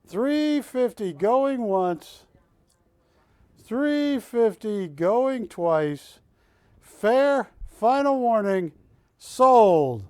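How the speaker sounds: slow, drawn-out auction call; auctioneer call. Acted.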